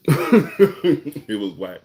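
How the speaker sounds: laughing out loud through the words; slow, loud, high burst of amusement. Real, not acted. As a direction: crack up while saying it. Laughs recalling his bad play.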